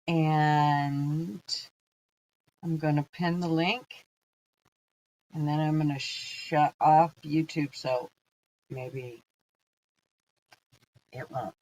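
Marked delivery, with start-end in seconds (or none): 5.3-11.5 talking while chewing gum; words around a wad of gum. Real, not acted. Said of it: "I can't talk and chew gum at the same time".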